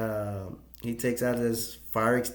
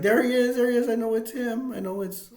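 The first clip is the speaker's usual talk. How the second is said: much higher and louder, eager and urgent; excited recognition; 12 semitones higher, 5 dB louder than usual. Retold or imitated. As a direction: point him out in a rush. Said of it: "Victoria's like".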